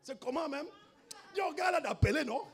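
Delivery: high-pitched mock challenge to the suitor; feisty woman, imitated. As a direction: imitate a woman telling a man off. La femme rabroue le prétendant. (imitation aiguë d'une femme qui rabroue)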